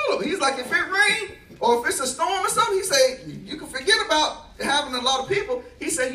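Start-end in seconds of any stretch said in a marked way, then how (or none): 0.6-5.6 laughing quote. Retold or imitated. Pastor's complaint retold, laughing.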